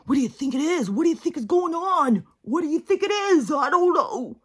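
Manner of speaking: high, far-off mocking whine of anxious posters; mock worried voice. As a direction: parody the panicked crowd asking questions.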